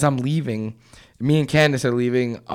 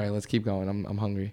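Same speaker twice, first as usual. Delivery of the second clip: softer, lower mumble; muttered brush-off; 6 semitones lower, 11 dB quieter, faster than usual. Retold or imitated. Telling friends to keep walking away from the stranger, quietly.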